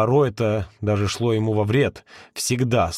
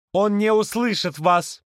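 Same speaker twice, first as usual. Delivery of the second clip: raised voice; 8 semitones higher, slower than usual. A narrator's reading. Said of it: "Призрак повысил голос".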